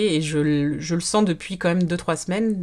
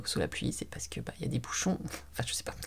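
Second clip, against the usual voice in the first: chuchoté; 5 semitones lower, 12 dB quieter, faster than usual. Real, not acted. A whispered aside.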